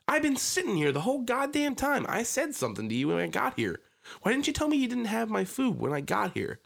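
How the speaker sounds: hammed-up indignant yell; retold yell. Yelling at the drive-thru worker, mock outraged.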